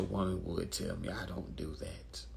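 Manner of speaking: unvoiced whispered aside. Real, not acted. Confiding to viewers, whispered.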